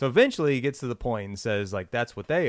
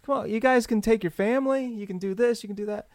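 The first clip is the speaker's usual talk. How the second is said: higher hard-sell coaxing; pushy salesman; 9 semitones higher than usual. Retold or imitated. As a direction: push the sale hard.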